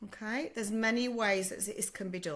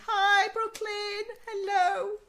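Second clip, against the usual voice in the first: sing-song greeting; 12 semitones higher, 6 dB louder, much slower than usual. Real, not acted. Greeting a viewer on the live.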